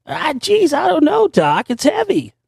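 mock Marty McFly with a cracking voice. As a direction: squeak it out like a flustered teenager. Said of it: "it's starting to sound like Marty McFly".

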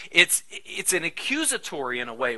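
normal talking voice

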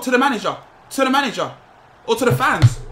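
hyped shout. Real, not acted. Gamer picking a goal celebration, loudly hyped. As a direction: call it out triumphantly.

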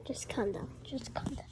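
soft whispered calm down; whispering. Real, not acted. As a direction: hush them in a whisper. Whispered calming after normal take.

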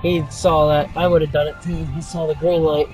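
distant in-car recording over music; dashcam audio. Real, not acted. Driver reacting in traffic.

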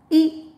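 "e" said ih, as in ink; short I sound. Shown as a demonstration. Teacher shows learners short I, "e" said ih. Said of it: "The short sound of I".

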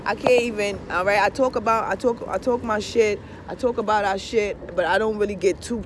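usual speaking voice

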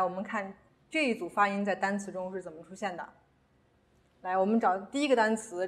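usual speaking voice

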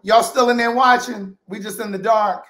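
loud, high call into the dark room. Real, not acted. Host calling out to viewers after the lights went out, loud and high. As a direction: shout to the viewers through the dark.

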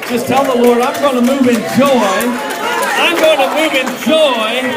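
loud, rising preacher's prayer; preacher praying loudly over the congregation; fervent loud prayer. Real, not acted. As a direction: pray over them with building force.

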